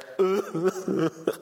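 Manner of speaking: high, hammed-up sobs; mock sobbing. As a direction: imitate bawling in short high bursts. Imitates the bawling, wordless.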